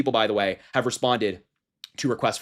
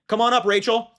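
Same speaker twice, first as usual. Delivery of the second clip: much higher and louder, a hammed-up host calling a contestant up; mock game-show host; 12 semitones higher, 6 dB louder than usual. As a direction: summon her like a game-show announcer, big and bright.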